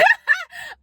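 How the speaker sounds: high, loud laugh burst. Real, not acted. Laughing after calling herself a weird ass bitch, loud and high. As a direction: crack up at yourself.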